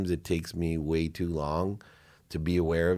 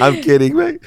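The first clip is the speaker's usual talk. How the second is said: much higher, louder laughing line; 12 dB louder than usual. Real, not acted. Guest echoing the joke, laughing loudly. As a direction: laugh it out big.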